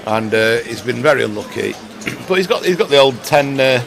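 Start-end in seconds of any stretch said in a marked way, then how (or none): none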